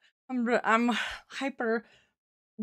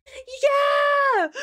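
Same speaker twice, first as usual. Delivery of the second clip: triumphant shout; 12 semitones higher than usual. Real, not acted. Gamer thinking she beat the boss, triumphant shout. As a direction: scream with joy.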